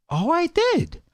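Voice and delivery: high sarcastic oh, I did; mock surprise. Customer scoffing at the waiter, in high mock surprise. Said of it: "he goes".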